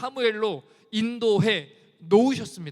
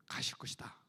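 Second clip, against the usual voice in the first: hushed whisper of trust; 11 semitones lower, 13 dB quieter than usual. Real, not acted. Preacher whispers God will lead us onward. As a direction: breathe it out under the voice. Whispered close of faith statement.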